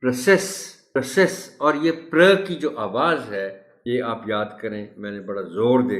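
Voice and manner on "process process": "process" high with a rising end, twice; model pronunciation. Shown as a demonstration. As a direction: drill "process" brightly upward. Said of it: "pronouncing the word as".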